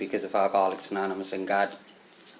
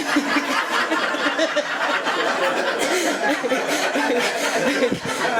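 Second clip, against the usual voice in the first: long high burst of real laughter. Real, not acted. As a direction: break up laughing at your own story. Long burst of laughter mid-story.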